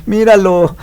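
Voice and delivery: put-on high, loud desperate boy's plea; a hammed-up kid begging; boy pleading. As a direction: beg her to look at it. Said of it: "el prota así de".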